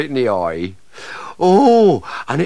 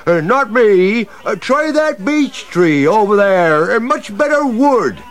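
high, slow, louder wheedling; nervous pleading; 10 semitones higher, 7 dB louder than usual. Acted. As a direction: talk them into the other tree. Tree pleads with axemen.

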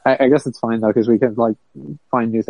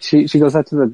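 ordinary delivery

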